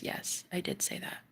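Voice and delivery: whispered quiet confirmation; soft whisper. Retold or imitated. Partner quietly confirming, whispered. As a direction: breathe it out softly. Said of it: "he goes".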